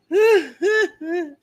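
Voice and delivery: loud, high mock sobbing; drawn-out put-on wailing; imitated crying. Shown as a demonstration. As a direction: sob loudly in imitation of her. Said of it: "I'm about to cry just like her".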